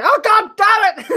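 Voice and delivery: high exasperated crowd groan; mock crowd groan. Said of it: "and they go".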